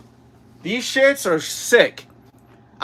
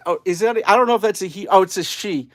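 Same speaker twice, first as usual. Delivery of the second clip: ordinary talk, nothing marked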